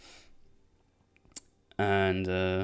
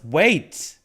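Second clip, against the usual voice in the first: short, higher, louder mock yell; raised-voice interruption; retold yell; 6 semitones higher, 5 dB louder than usual. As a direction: cut in with an imitated shout. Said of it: "Andor just yells".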